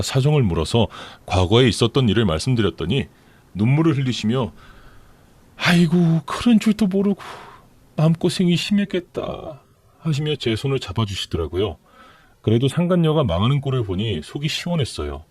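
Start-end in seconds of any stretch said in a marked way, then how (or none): 5.6-9.6 put-on higher, tender, tearful old mother. Mother crying over her daughter's hardship, tearful and higher. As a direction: comfort your daughter through tears.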